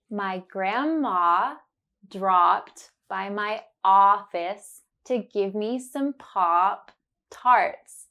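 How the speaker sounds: slow, deliberate, every ah clear; slow and careful. Shown as a demonstration. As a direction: spell it out slowly for the learner. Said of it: "say it slowly and focus on the sound".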